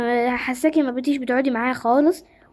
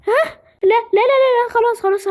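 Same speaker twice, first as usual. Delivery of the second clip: panicked no; 10 semitones higher, 4 dB louder than usual. Acted. Panicked realisation, repeated no.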